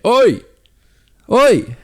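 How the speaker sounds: loud high yell of "Oi!"; calling out. Retold or imitated. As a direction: bellow across the traffic. Man calling out from roadside.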